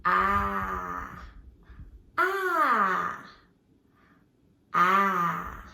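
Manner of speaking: "ah" said as a long open short-a sound; letter A sound. Shown as a demonstration. Teacher shows kids the sound of letter A, drawn-out "ah". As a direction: hold out a clear "ah" three times. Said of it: "the sound it makes is".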